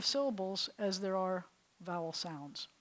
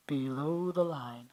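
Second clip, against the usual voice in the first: low, slow drop in pitch; dropped low voice; 6 semitones lower, slower than usual. Shown as a demonstration. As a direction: sink the voice down slowly. Names the vowel group below.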